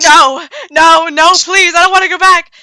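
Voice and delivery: loud, high, desperate begging; acted plea of a girl being captured; panicked pleading. Captured girl pleads not to return.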